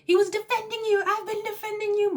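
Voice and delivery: very high, fast mock yelling; hammed-up shrieky protest; retold screaming. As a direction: imitate someone losing it, pitch shooting up. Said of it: "Margaret loses it. She's screaming at Jackie".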